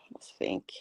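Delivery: a sighing whisper; whispered thinking. Real, not acted. Client whispering to herself while recalling. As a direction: breathe it out as you think.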